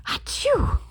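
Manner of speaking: high loud achoo; hammed-up sneeze. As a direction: sneeze out hard.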